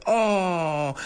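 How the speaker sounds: high, crying oh; anguished oh. Shown as a demonstration. Host acting out despair, hand to head, crying oh. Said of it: "hand to head".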